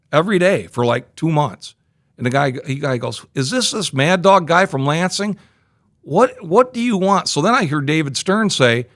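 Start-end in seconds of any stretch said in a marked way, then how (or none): 3.3-7.3 higher-pitched mock exasperated demand; imitated impatient phone answer; retold exasperation. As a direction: act out a man fed up with your calls. Retold annoyed answer to repeated calls.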